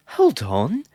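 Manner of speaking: loud, high cutting-in protest; hot interjection. A narrator's reading. Rowan heatedly stops Caleb from leaving. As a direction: snap in to stop them. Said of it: "Rowan interjected hotly".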